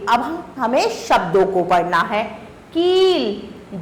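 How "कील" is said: drawn-out, syllable by syllable; slow model reading. Shown as a demonstration. Teacher modelling the word slowly for pupils. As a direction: stretch the word out slowly for the class. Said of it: "शब्दों को पढ़िए".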